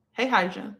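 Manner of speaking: ordinary delivery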